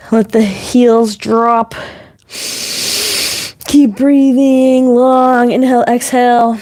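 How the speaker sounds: slow, calm yoga cue, stretched out. Shown as a demonstration. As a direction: let each word settle as you breathe out. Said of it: "to kinda slow down".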